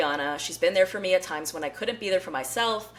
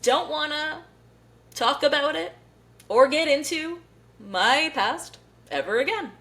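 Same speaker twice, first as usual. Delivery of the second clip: much higher, mock-dainty dodge, slowing at the end; lightly hammed-up imitation of a star brushing off the question; evasive J Lo; 8 semitones higher than usual. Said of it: "And she's just like".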